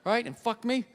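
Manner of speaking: fast, bitter inner rant; fast resentful rant. Retold or imitated. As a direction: rattle it off in a huff. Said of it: "I'm like".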